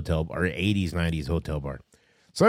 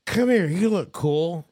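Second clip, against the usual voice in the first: put-on much higher, slowing down at the end, friendly drunk come-on; lightly voiced barfly waving him over; drunk stranger; 13 semitones higher, slower than usual. Storyteller imitates a drunk man at the bar calling him over. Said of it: "he goes".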